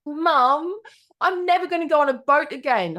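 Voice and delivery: put-on high-pitched insistent little kid; six-year-old daughter. Six-year-old telling mum at the table, high child voice. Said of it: "my little six-year-old daughter".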